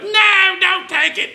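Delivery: very high, squeaky cartoon plea; hammed-up high-pitched protest; pleading chlorine. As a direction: squeal it like a tiny element begging. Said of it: "Every time I walk by chlorine, I hear that".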